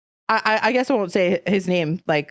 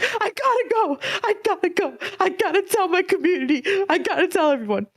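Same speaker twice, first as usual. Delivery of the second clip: high, laughing, giddy rush; bubbling over, can't wait to share; overjoyed; 10 semitones higher than usual. Retold or imitated. Hanging up on mom to tell her community, giddy and high.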